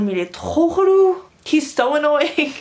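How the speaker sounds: high-pitched griping to a friend; hammed-up exasperation in French, then English; annoyed. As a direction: complain theatrically about the annoying classmate. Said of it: "I would turn to my friend".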